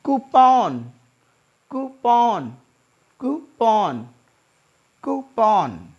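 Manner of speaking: कूपन as koo-pan; कूपन, long oo; correct pronunciation. Shown as a demonstration. Teacher modelling coupon. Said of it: "It is C O U P O N".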